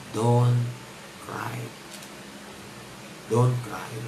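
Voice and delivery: quietly consoling a mourner; gentle comforting. Acted. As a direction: say it tenderly, with space between words. Preacher voices Jesus comforting the widow.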